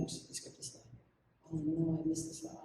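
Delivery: muttered under the breath to herself; hushed oops to herself; whispered aside. Real, not acted. Teacher's aside on a slide slip.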